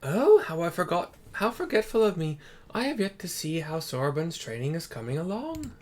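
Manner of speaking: hammed-up elder suddenly remembering; Genbu voice.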